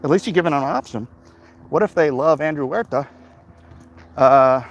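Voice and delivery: ordinary talk, nothing marked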